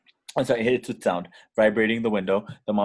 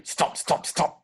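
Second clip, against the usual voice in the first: stomping sound effect; 8 semitones higher, slower than usual. Acted. Monster footsteps effect.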